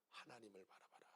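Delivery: soft whisper; hushed whisper. Real, not acted. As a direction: breathe it like a secret. Sudden whisper.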